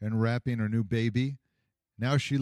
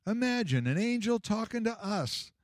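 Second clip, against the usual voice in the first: put-on high, eager old shepherd; rising excitement; 10 semitones higher than usual. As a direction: let the wonder run away with you. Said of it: "with rising excitement".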